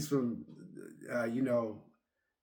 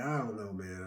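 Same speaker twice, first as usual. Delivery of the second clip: lower, much softer doubt; doubtful mumble; 8 semitones lower, 11 dB quieter than usual. Retold or imitated. Telling about the coworker's tip, a soft doubtful mumble. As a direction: mutter it off, unconvinced.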